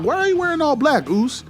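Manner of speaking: high, worried call-out to Jimmy; alarmed question. Retold or imitated. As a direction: ask it up high in mock alarm. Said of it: "I'm like".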